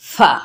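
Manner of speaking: pure f into the vowel in फा; फा without w-sound. Shown as a demonstration. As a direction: say फा with no वाव sound creeping in. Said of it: "वाव की उसकी sound ना आए". (फा में वाव की आवाज़ न आए)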